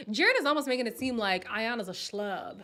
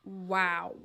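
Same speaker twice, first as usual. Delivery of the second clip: ordinary talk, nothing marked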